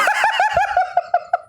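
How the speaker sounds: shrieking burst of laughter; high-pitched laugh. Real, not acted. Reacting to the AI's line, a high laughing shriek. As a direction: crack up in a high squeal. Cracking up at the story.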